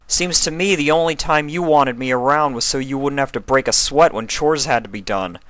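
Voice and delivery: voice rising slightly. Acted. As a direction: let the resentment climb toward the end. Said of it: "his voice rising slightly towards the end".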